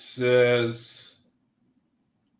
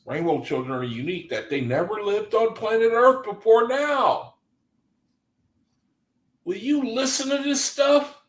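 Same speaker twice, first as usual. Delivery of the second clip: mock amazed high voice; 9 semitones higher than usual. Incredulous mock reading.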